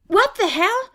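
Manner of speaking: high loud shocked yelp; startled outcry. A narrator's reading. Angel shoved into the dark tree, startled. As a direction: cry out as you drop.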